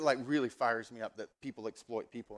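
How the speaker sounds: ordinary talk, nothing marked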